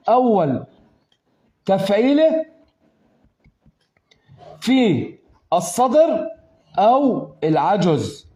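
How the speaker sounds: slow, spaced words to drive the answer home; slow emphasis. Shown as a demonstration. Teacher answering the student, slow and emphatic. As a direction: stress each word slowly for the student. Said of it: "teacher stresses the answer".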